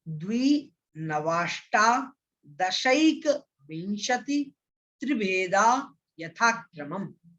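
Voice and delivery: very slow, syllable by syllable, each piece apart; broken-up recitation. Shown as a demonstration. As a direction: split the sutra into slow chunks to teach it. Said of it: "इसका तोड़ तोड़ करके उच्चारण करेंगे".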